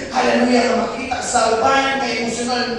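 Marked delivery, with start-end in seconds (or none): none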